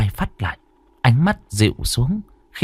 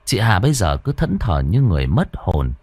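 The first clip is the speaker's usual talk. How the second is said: ordinary delivery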